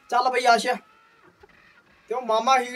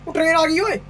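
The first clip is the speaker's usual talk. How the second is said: alarmed call; 6 semitones higher than usual. Real, not acted. Train suddenly coming at them.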